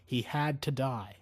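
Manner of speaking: ordinary delivery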